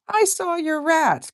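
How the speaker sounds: high, drawn-out mimicry of a friend; imitated friend. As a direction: stretch it out in a high, put-on voice. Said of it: "and she's like".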